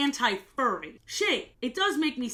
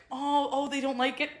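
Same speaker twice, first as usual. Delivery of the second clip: ordinary talk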